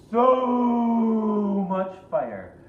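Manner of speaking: loud, slow, high roar of fire; fire-breathing roar. A narrator's reading. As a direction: blast the words out like flames.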